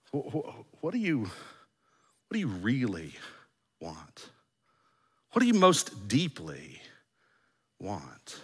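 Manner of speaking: slow, soft, falling away on "want"; hushed probing question. Acted. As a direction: probe gently, pausing before the last word.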